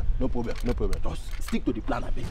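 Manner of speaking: 1 low conspiratorial. Acted. Fake officer drops to low voice.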